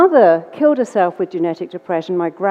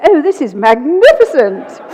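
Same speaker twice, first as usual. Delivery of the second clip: posh delight; 5 semitones higher, 6 dB louder than usual. Retold or imitated. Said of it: "and she went".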